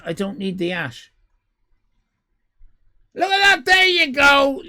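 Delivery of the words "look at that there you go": very high, very loud cheer; excited shout. Real, not acted. Streamer cheering as the prize finally works, loud high yelp. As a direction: yelp with delight at the win.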